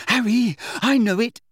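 high, loud, panting while jogging; out of breath from running. A narrator's reading. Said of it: "someone panted".

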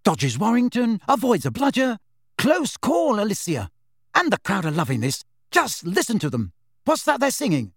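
cheeky, high sports commentator; lively match commentary with a wink; Lee Jordan commentator. A narrator's reading.

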